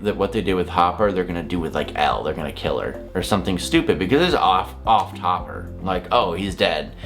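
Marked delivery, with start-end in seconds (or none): none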